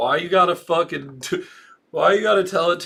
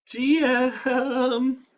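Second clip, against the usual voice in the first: higher, drawn-out sing-song; 7 semitones higher than usual. Real, not acted. Parent echoes toddler's greeting, high and sing-song. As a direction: model it for a little one to copy. Repeats word for small child.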